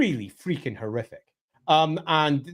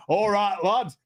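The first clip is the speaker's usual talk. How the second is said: higher-pitched mock Yorkshire dwarf; Yorkshire accent; 4 semitones higher, slower than usual. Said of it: "Don't forget Yorkshire".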